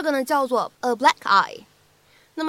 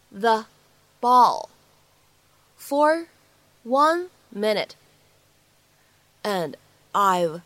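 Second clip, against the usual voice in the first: word by word; 3 dB louder, much slower than usual. Shown as a demonstration. Teacher breaking down a dialogue line, word by word. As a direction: read it word by word, long pauses between.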